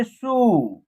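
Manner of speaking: सु stretched into a long सू; long vowel. Shown as a demonstration. Teacher demonstrating one letter with its matra, सु drawn out. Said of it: "उच्चारण long तरीके से करेंगे".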